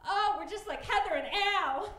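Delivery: mock gushing celebrity, high and loud; hammed-up delighted squeal of a boast; Heidi Klum. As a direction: gush it in a put-on excited voice. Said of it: "Heidi Klum is sitting around going".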